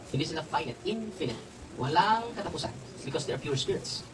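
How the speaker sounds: ordinary talk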